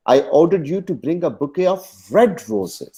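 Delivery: "red" pushed high and drawn out; stress on RED. Shown as a demonstration. Teacher shows the colour wanted, stress on red. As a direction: slowly, punch the word "red". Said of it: "सुर्ख फूल चाहिए थे".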